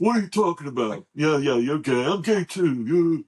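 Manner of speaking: jeering along, building in volume; mocking disbelief. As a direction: imitate a brother who thinks it's a joke. Brother disbelieves, mocks the coming-out.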